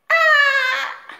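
hammed-up cry of pain; mock pained cry. Real, not acted. Loud cry.

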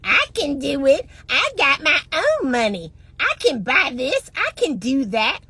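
a lightly voiced, cocky independent woman; mildly mock the proud attitude; a hint of a smug boast. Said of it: "that egoist way".

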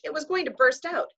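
ordinary delivery, nothing marked

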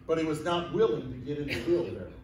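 ordinary talk

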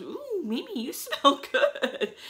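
high, drawn-out mock swoon; playful imitation of a gushing compliment; imitating delighted sister. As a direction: coo the compliment high, with a laugh.

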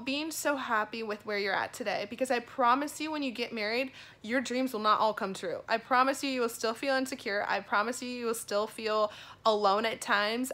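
ordinary delivery

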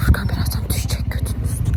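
sneaky whisper aside. Real, not acted. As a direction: whisper it so he won't hear. Secret prediction about friend falling. (fısıldayarak)

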